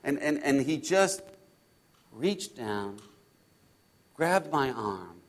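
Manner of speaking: low, soft, slow storytelling; slow down and hush, with reverent pauses; hushed awe. Acted. Disciple recalling Jesus lifting him from the storm, hushed awe. Retelling the rescue in calm.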